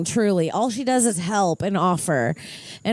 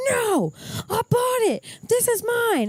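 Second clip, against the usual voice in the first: much higher, indignant imitation of mom; 14 semitones higher than usual. Mocking her mom grabbing the charger, high indignant voice. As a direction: squeal it like a stubborn mother claiming the charger. Acting mother's charger quarrel.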